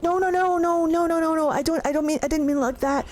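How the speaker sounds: hammed-up embarrassed Solas; flustered denial. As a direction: backpedal fast in a panic. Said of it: "And Solas is like".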